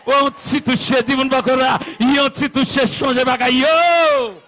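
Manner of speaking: loud, high chanted sermon cadence; full-force preaching. Acted. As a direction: build phrase by phrase to a shouted climax. (prèch ak tout fòs, sou ton chante)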